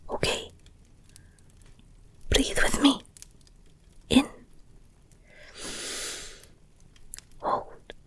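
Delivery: soft, calming breath coaching; soothing reassurance. Acted. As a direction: talk them gently down from panic. Calming listener, guiding their breathing.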